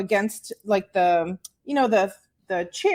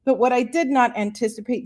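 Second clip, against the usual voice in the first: ordinary delivery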